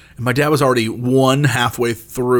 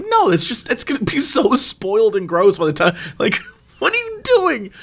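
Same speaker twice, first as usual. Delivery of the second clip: laughing disbelief; 8 semitones higher than usual. Retold or imitated. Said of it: "I was like".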